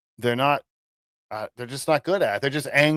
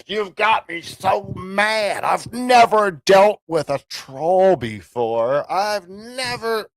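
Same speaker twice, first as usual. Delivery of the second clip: high, slow, sarcastic mock fury; mock outrage; 8 semitones higher, 5 dB louder, slower than usual. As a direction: pretend to be triggered, hammed up. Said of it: "Come on, man".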